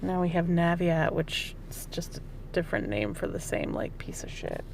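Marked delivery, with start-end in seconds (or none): 1.1-4.6 hushed disgusted whisper. Real, not acted. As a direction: whisper the gripe under breath. Griping about loan servicer.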